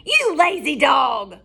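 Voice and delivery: slow, loud witch scolding; witch shout. Acted. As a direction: shout down the lazy dog. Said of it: "shouted Bony Legs".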